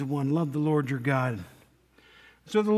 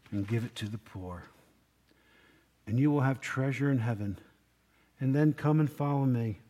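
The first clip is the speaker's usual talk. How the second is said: tender Jesus; 5 semitones lower, 5 dB quieter than usual. Acted. Jesus speaks lovingly to rich man.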